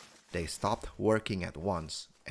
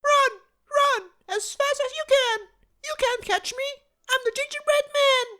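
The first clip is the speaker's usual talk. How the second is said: very high, louder taunt, fast at the end; gingerbread man calling back; 8 dB louder than usual. A narrator's reading. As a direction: call back over your shoulder, teasing. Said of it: "he called back to the three mowers".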